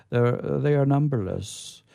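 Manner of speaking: ordinary delivery, nothing marked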